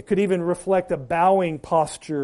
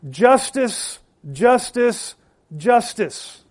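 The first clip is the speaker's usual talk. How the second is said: higher-pitched, measured cry of each word; 5 semitones higher, much slower than usual. Pretend. Preacher voices angels to the congregation, crying out. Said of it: "crying out".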